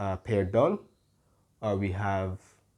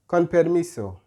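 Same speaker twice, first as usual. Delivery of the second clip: polite call for space; 8 semitones higher, 7 dB louder than usual. Shown as a demonstration. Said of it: "So we say".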